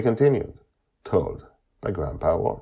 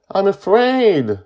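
put-on high, loud, drawn-out child's wail; tearful fear; 13 dB louder, slower than usual. A narrator's reading. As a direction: confess fear as a crying little boy. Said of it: "suddenly begins to cry".

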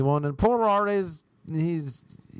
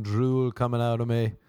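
ordinary talk

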